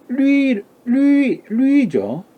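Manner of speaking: 리 said very high, modelled twice; pinyin pronunciation. Shown as a demonstration. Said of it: "리란 발음도".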